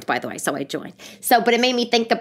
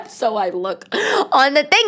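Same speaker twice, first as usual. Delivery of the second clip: laughing; 6 semitones higher, slower than usual. Real, not acted. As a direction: laugh through the words, slowly. Laughing while telling.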